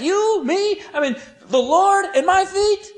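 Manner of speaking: "You?" and "Me?" as loud, high, shocked questions; emphatic pronouns. Acted. Peter balks at the Lord serving him, emphatic pronouns. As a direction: punch "You", "Me", "Lord" and "my feet" in high disbelief. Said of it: "And the pronouns are emphatic".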